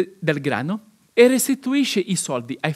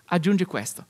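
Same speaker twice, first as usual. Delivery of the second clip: normal talking voice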